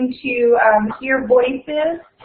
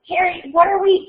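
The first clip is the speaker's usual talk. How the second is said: lightly voiced Ron, higher; 8 semitones higher than usual. Ron's worried question.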